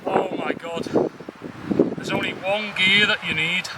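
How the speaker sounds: soft, breathless after the climb; out of breath. Real, not acted. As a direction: gasp it out, winded from pedalling. After riding uphill with trailer.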